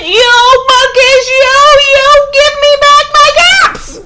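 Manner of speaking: furious high, loud shout, fast. Acted. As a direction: yell it red-faced at the monkeys. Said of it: "And he shouted".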